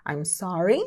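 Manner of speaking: "I'm sorry" starting low, lifting on "sorry"; question intonation. Shown as a demonstration. Teacher recaps polite ways to ask again, "I'm sorry" with questioning rise. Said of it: "com essa entonação de pergunta".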